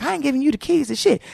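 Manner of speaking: high, fast mock husband voice refusing; a hint of the husband snapping back; gruff refusal. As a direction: fire it back like he's refusing her. Husband refusing during fight.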